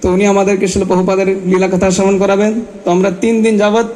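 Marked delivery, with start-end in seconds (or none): none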